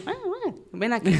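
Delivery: put-on a child's whimpering copy; imitating crying child. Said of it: "Y seguía llorando". (imitando a una niña que llora)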